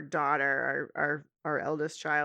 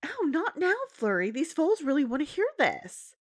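higher, gently distracted mom; Twilight's voice; 9 semitones higher than usual. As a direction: brush off the kid kindly, higher.

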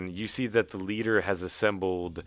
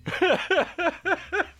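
a high-pitched burst of laughter; 4 dB louder than usual. Real, not acted. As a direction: squeal with laughter. Laughing at the toast.

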